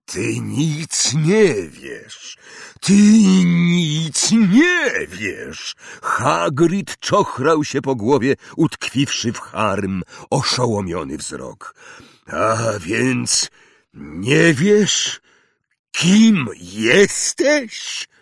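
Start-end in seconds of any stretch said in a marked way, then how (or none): 0.1-5.8 very slow, heavy disbelief; stunned giant. Acted. Hagrid stunned. 12.3-18.1 incredulous. Acted. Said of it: "zapytał w końcu".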